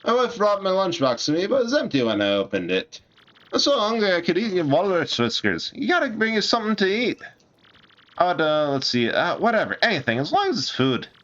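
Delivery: a starving dockhand whining for lunch; hungry dock worker. Acted. Dock worker begs Mario to bring food, high and pleading.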